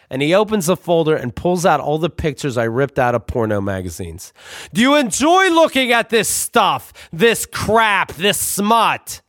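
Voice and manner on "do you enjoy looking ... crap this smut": much higher, slow mock outrage; scandalized dad. Father scolding his son over the pictures, high and outraged.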